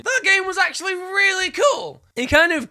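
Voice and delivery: high, loud burst of enthusiasm; excited shout. Real, not acted. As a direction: gush about it at full volume. Gushing over a favourite racing game.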